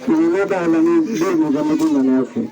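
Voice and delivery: ordinary talk, nothing marked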